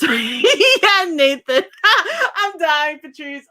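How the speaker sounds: laughing. Real, not acted. Laughing at a viewer's comment.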